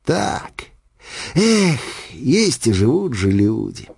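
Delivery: slow, wistful envious sigh; a touch of drunken envy. A narrator's reading. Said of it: "с оттенком зависти".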